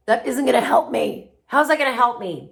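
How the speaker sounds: loud, doubtful complaining; skeptical whine. Retold or imitated. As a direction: scoff like a doubting student. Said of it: "they're like".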